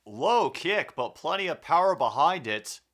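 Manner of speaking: energetic sportscaster call; play-by-play commentary. Acted. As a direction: call it like a live broadcast. Calls the game play-by-play.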